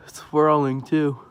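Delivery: loud amazed call; calling out in awe; excited. Real, not acted. Watching a funnel cloud.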